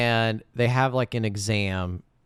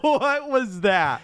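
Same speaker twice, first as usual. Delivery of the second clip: mock disbelief; 13 semitones higher, 5 dB louder, much slower than usual. Real, not acted. Incredulous reaction to bad accent.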